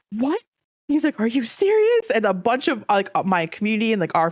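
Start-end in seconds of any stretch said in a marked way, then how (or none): 0.1-2.1 high, stunned mock exclaim; a light imitation of a shocked friend; disbelief. Friend on stream hearing the partner news, mildly mock shocked.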